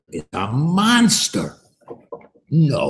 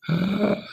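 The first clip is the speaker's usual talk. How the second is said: gasp; 11 semitones higher than usual. Acted. Bobby finds terrible surprise.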